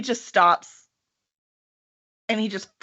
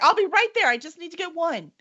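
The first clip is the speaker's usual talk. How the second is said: put-on eager girl; 8 semitones higher than usual. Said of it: "She's like".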